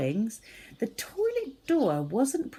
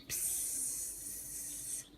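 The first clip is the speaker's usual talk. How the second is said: breathy, very soft psst; a faint hissing spray; 18 dB quieter than usual. Acted. The rat's wee hits the wall. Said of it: "decorating the wall".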